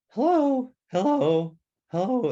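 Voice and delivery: loud, high cracking hello; high, loud mimicked adolescent voice; boy's breaking voice. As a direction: imitate a boy whose voice is starting to go. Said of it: "What's wrong with your voice?".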